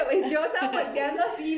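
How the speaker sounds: ordinary talk, nothing marked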